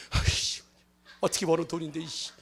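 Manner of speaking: whispered, quiet grumble through teeth; muttering over lost money; grudging mutter. Pretend. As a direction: hiss it grudgingly. Grinding teeth giving money away.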